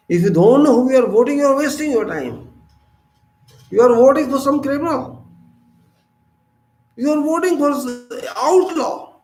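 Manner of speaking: high, loud and fast rebuke; fiery high reproach; scolding. Real, not acted. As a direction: scold the voters for wasting it. Scolding voters in a speech.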